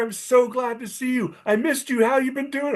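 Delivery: high, gushing greeting voice; imitating an eager friend.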